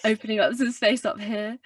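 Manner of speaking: low-larynx voice with added brightness; a bit brighter, still resonant; brightened low larynx. Shown as a demonstration. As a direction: open space up top to brighten the tone. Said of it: "try and brighten it a bit".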